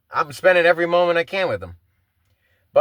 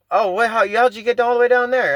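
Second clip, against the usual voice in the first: higher-pitched, mildly incredulous dad on the phone; imitated surprised parent; 6 semitones higher than usual. Dad asking how his kid got so far, mock surprise. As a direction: retell Dad's puzzled question with raised pitch.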